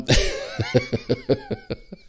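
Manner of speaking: sudden high-pitched burst of laughter. Real, not acted. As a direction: break into a squealing laugh. Laughs at own rant.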